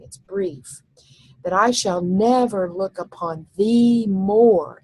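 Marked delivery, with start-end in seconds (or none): 1.5-4.8 thee and more stressed, slow. Shown as a demonstration. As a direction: slowly land on thee and more. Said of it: "thee and more I think are both accented".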